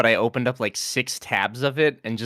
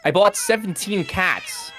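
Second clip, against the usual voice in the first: dramatic actress; 8 semitones higher than usual. Acted. Dramatic duologue performance.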